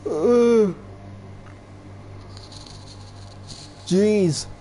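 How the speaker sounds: hiccuping between words; hiccups. Real, not acted. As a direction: talk through hiccups. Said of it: "I got hiccups".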